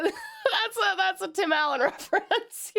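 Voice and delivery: laughing so hard it cracks high; real giggling fit, can barely get it out; helpless laughter. Real, not acted. Streamer loses it laughing at a joke in chat. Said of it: "I'm so loopy".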